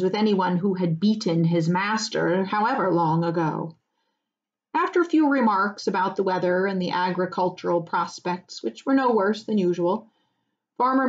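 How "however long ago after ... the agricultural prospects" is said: ordinary delivery, nothing marked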